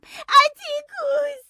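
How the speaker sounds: high, loud, drawn-out slow call. Acted. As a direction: stretch out the name, calling for help. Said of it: "صدا زدم". (صدا زدن بلند و کشیده)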